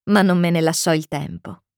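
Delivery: usual speaking voice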